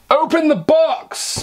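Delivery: high, slow drawn-out cry; excited cry. Acted. Cheering himself on to open the box. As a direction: call it out like a game show.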